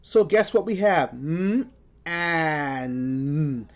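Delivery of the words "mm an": sounds split apart, M then AN; segmented sounds. Shown as a demonstration. Teacher sounding out "Mm, an" for the class. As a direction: say "Mm" and "an" as separate sounds. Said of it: "we're gonna put the N sound here".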